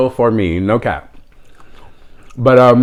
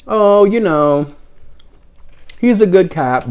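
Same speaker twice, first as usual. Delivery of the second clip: higher, slow, sugary sales pitch, lightly voiced; imitating the gushing clerk; 8 semitones higher, slower than usual. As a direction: drag out the Oh like a sweet, pleading shopkeeper. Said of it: "And she was like".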